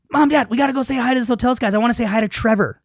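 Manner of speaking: put-on high, quick kid voice; imitating an excited kid. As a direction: bounce it up like an eager little girl. Child voiced in retold story.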